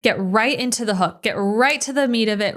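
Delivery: usual speaking voice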